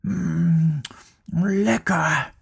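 happy munching cub. Acted. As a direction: relish the bite like a cub.

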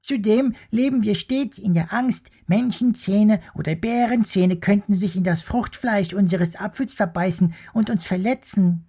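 cross little worm. Acted. Worm scolds the bear for disturbing him, squeaky and cross. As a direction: scold like a tiny fussy creature.

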